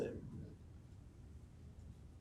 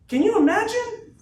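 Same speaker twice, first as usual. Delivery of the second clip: a slow, booming, much higher shout of the question; 9 dB louder, slower than usual. Real, not acted. Speaker to his sales team, roaring the question. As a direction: roar it to lift the whole room. Hyping crowd about big event.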